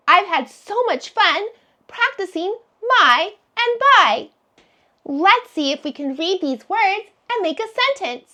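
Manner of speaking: ordinary talk, nothing marked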